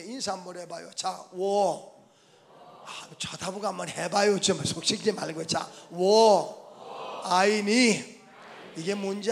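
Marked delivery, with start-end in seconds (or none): 1.3-1.9 워 held very long and slow; teaching model. Shown as a demonstration. Preacher teaching Chinese for neighbours to repeat, 워 slowly drawn out. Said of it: "중국말 하나 가르쳐 줄게요". 5.9-8.2 워 and 아이니 slowly drawn out, phrase by phrase; teaching model. Shown as a demonstration. Preacher teaching Chinese to the congregation, 워, 아이니 slow for repeating. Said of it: "중국말 하나 가르쳐 줄게요".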